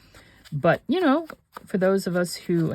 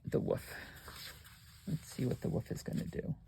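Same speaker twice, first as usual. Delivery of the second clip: whispering; 12 dB quieter than usual. Real, not acted. Whispered aside while crafting.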